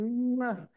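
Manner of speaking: high, loud imitated kiss smack; exaggerated high "mwah"; toddler's kiss. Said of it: "he comes over and he goes".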